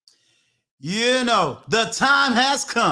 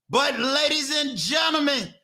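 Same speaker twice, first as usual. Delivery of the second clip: big, high showman announcer call for the guest; announcer hype intro; 5 semitones higher, slower than usual. Acted. Host bringing on the guest to the audience, hyped announcer voice. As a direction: pump up the crowd like a stage MC, raised pitch.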